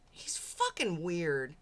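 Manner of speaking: normal talking voice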